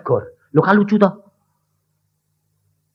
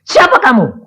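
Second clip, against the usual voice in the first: loud, high challenge; stern demand; 9 semitones higher, 6 dB louder than usual. Retold or imitated. Preacher loudly demanding the demon's name. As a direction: bark the question at the spirit.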